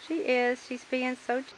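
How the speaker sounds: normal talking voice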